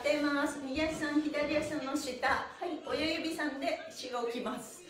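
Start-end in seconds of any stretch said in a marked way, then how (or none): none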